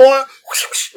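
high, laughing whip swishes; whip whoosh. Retold or imitated. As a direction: swish the whip sound, giggling. More whip noises, laughing.

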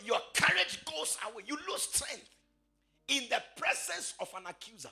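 high, ringing, half-sung preaching; preaching at full force. Acted. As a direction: preach it out to the congregation.

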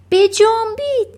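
loud, high urgent yell; shouting. A narrator's reading. Matilda rallying her family to hurry, shouting. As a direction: shout to get everyone moving. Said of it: "ماتیلدا داد زد".

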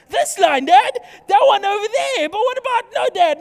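put-on high, loud, insistent child pleading; daughter Rebecca pushing limits. Pastor acting his daughter nagging dad, high and loud pleading child. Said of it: "It's like my daughter, Rebecca".

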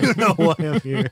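joking menace with a laugh; laughing mock tough. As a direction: play tough but crack up. Mock tough schoolyard exchange.

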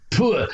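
breathy wind-up, then a spat "put"; imitated spitting. Old lady spitting at the wrestler, imitated spit sound. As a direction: mimic hawking and spitting. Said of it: "she just went".

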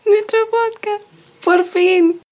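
high, slow and triumphant; excited. Pretend. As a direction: celebrate it in a high squeal. Exaggerated celebratory high voice.

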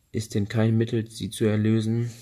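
ordinary delivery, nothing marked

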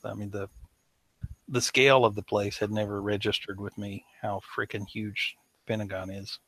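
ordinary delivery

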